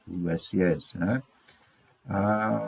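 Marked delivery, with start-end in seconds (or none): 2.1-2.6 syllable by syllable. Shown as a demonstration. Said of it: "aí está a separação silábica". ("Assinatura" sílaba por sílaba, bem pausado)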